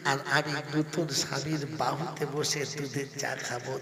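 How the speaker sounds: ordinary delivery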